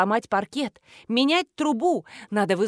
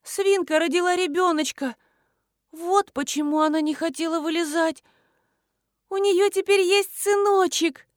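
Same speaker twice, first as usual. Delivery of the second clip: sustained high-pitched gushing; thrilled wonder; 8 semitones higher than usual. A narrator's reading. Nina believing the pig gave birth, thrilled wonder. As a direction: announce it high, with delighted excitement.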